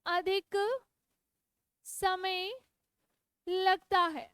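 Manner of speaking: word by word with long pauses; slow dictation. Shown as a demonstration. Teacher dictating the definition for students to note down, slowly. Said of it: "मेरे साथ साथ लिखो".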